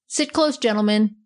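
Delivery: ordinary delivery, nothing marked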